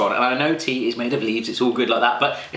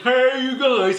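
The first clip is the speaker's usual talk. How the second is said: Sloth impression; 10 semitones higher, slower than usual. Pulling a face at bad tea, doing a Sloth-like voice. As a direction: blurt it like a big friendly monster. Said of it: "I feel like Sloth from the Goonie".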